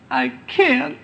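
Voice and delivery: high, pitiful whimper of a stuck dead man. Acted. Lazarus whimpering back at Jesus from the tomb. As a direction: whine it like a helpless mummy. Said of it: "In a whimpering voice".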